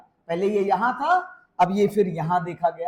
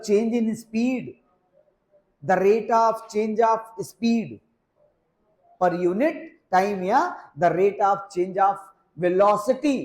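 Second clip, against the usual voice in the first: slow dictation; much slower than usual. Shown as a demonstration. Teacher dictating a definition.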